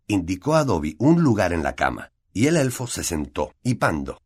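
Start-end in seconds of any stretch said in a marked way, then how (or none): none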